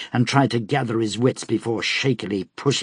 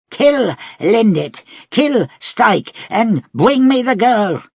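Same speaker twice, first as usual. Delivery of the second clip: high, loud commanding yell, slow on the first name; furious shouted order; 12 semitones higher, 7 dB louder, slower than usual. Acted. Devori commanding his men to kill and seize the girl, yelling. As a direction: roar the kill orders at the fighters.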